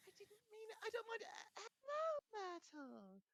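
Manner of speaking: hushed panic rising into a high greeting; flustered cover-up. A narrator's reading. Flustered Hermione covers up to Myrtle.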